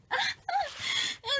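very high, delighted squeal of a laugh; real squeal of delight. Real, not acted. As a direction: let out a thrilled squeal. Squeal at old drawing.

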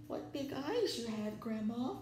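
high, timid voice with little whisper; fearful hushed voice. A narrator's reading. Shaking wolf to Grandma, timid hushed voice. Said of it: "he finally whispered".

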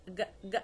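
ج as a hard g, without softening; short plosive ج, never zh. Shown as a demonstration. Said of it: "بدون تعطيش".

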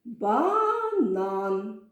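"Банан" drawn out slowly, with a long "ба" gliding into "нан"; stretched to find stress. Shown as a demonstration. Teacher tests where the stress falls, stretched "Банан". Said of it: "на який склад падає наголос".

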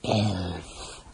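low, soft creature hiss. A narrator's reading. Draconian spotting an elf among the companions, hissing. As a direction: hiss it low, like a reptilian soldier. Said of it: "a Draconian hissed".

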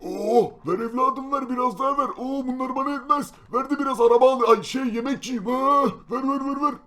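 put-on greedy old man. Old man demanding more.

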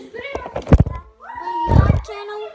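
loud, slow shocked yell; surprised shout. Real, not acted. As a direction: shout it in disbelief. Surprised shout at friend's claim.

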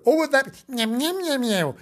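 high little meows, trailing down; mewing kitten. Acted. Kitten protesting to the dog, mewing. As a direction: mew in a tiny high voice. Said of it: "mewed Timbo".

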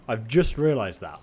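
ordinary talk, nothing marked

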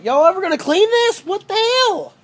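high, loud shocked outburst; shocked disgust. Real, not acted. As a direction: react to the mess in disbelief. Shocked at dirty shed.

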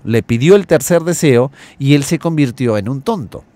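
ordinary talk, nothing marked

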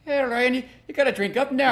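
a light tease urging a drink; mock pushy drinker. Imitating drinkers urging Ryan.

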